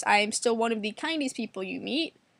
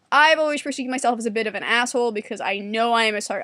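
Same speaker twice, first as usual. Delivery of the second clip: usual speaking voice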